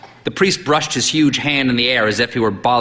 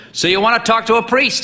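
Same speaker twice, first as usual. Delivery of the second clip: put-on high, booming old priest; hearty old priest; 12 semitones higher than usual. A narrator's reading. Old priest sizing up the sick boy, hearty. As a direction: boom at the boy like a brisk priest.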